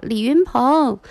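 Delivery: mild mock yell of a name; retold yell. Husband turning on his wife over the child, mock yell. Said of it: "他就吼我".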